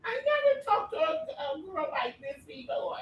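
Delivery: high-pitched mock outburst, dropping at the end; Tyra Banks. Co-host teasing with a famous TV scolding, high mock outburst. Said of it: "you sound like, uh, Tyra Banks".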